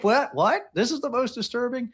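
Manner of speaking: high, incredulous "what? what?"; teasing imitation of a stunned listener; mock disbelief. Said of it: "You're like".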